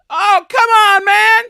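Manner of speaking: frustrated shriek; exasperated shout. Real, not acted. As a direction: yell it at the screen. Raging at the game.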